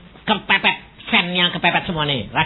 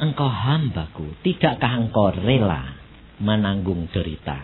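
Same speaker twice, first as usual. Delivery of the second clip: voice of God; 10 semitones lower, 4 dB quieter than usual. Retold or imitated. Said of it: "Karena Tuhan sudah ngomong".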